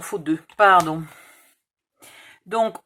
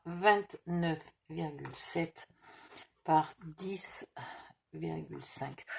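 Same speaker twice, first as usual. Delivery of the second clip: soft murmur fading to a whisper; murmured while writing; 4 semitones lower, 10 dB quieter, slower than usual. Real, not acted. Crafter writing the size on the cardboard, murmuring under her breath. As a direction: mumble the numbers to yourself as you write them down.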